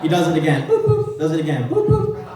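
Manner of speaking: high mock boop boop beeps; scanner beeps. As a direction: imitate a failing ticket scanner, boop boop. Mimicking scanner error beeps.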